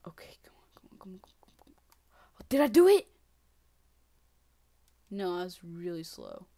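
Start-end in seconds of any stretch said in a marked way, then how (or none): 0.1-2.4 hushed urging under the breath; tense whisper. Real, not acted. Gamer whispering to his character before a risky jump. 2.4-3.1 excited. Real, not acted. Sudden hope after the jump.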